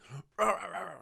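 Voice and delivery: throaty run of dog barks done by mouth; imitated dog barking.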